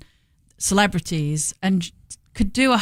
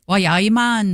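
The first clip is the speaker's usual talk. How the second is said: quick burst of broad Geordie, a touch louder; Geordie accent; 4 dB louder than usual. Retold or imitated. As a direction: toss off a Newcastle "why aye, man". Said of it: "probably the Geordie accent".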